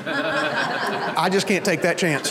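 slow, laughing through the words; laughing punchline. Acted. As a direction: chuckle out the joke's last line.

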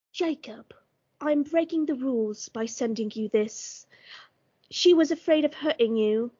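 fancy British gone a bit Cockney; attempted British accent. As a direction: read a note in a hammy British accent. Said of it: "I try to do a British accent, but I get, I get too Cockney".

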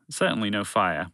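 plain reading voice

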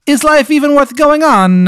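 high, slow hammed-up Italian accent; mock Italian voice. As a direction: sob it out like a cartoon Italian in grief. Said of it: "stuck in a fucking Italian guy's voice".

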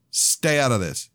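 snapping. A narrator's reading. Son snapping at Polly to keep out of the fight. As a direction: cut her off hard. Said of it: "I snapped".

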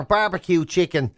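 ordinary delivery

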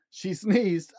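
ordinary delivery, nothing marked

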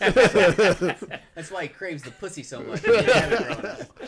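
loud, high, through laughter; laughing. Real, not acted. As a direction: talk while cracking up. Joking aside, laughing.

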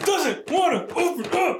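high, slow, strained words; straining effort. Real, not acted. Player wrestling a sealed box, straining with effort. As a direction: push the words out while forcing something open. Struggling to open the game box.

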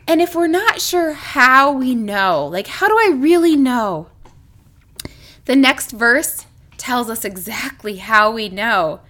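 normal talking voice

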